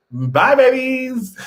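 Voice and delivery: signing off to the viewers, slow and high; drawn-out sign-off. Real, not acted. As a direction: sing out a fond farewell.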